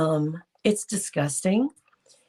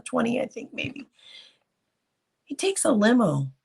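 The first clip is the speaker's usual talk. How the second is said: ordinary delivery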